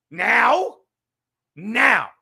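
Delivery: loud indignant yell; shouted now. Real, not acted. Streamer yells at fans saying he'll win now, indignant shout. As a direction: yell it back in disbelief.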